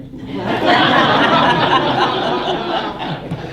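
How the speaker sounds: a high, breathy burst of laughter; big amused laugh; hearty laugh. Real, not acted. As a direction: laugh at your own joke. Laughs at own fibbing joke.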